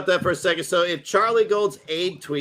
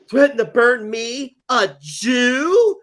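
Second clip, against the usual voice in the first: higher, louder, slow mock outrage; 6 semitones higher, 5 dB louder, much slower than usual. Mocking the outraged tweet with hammed-up offense. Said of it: "be like".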